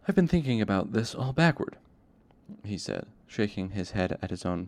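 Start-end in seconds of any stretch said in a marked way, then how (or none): 0.1-1.8 higher, louder burst of realisation; suddenly brightened. A narrator's reading. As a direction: perk up, struck by a new idea. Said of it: "Chronicler brightened suddenly".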